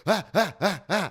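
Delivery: mock panic. Panic on stage imitated.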